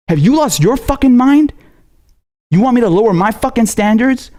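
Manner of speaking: sharp, high angry outburst; furious outburst. Real, not acted. Ranting at viewers who idolize politicians, furious high outburst. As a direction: blast it at them in disbelief.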